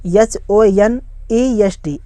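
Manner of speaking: very slow, separated letters; one letter at a time; spelling it out. Shown as a demonstration. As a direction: spell it slowly for learners. Spelling a word aloud for learners.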